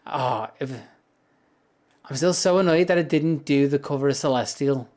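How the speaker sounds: ordinary talk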